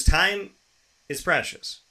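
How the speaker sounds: ordinary delivery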